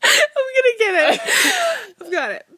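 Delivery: high, breaking laugh-cry; trying to talk through laughter; laughing till crying. Real, not acted. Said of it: "I'm sobbing".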